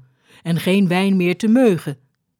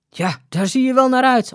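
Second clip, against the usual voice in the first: raised, higher-pitched sarcastic retort; scoffing; 7 semitones higher, 3 dB louder than usual. A narrator's reading. Skipper to the disguised woman, scoffing disbelief. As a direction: scoff at an unlikely claim, pitch up.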